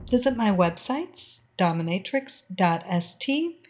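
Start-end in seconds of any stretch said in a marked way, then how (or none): none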